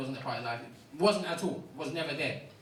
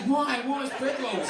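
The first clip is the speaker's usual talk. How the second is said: mock innocent guy; 5 semitones higher, 3 dB louder, slower than usual. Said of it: "And he's like".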